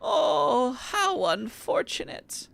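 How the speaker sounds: put-on high old granny voice; grandma voice. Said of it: "Love my grandma voice".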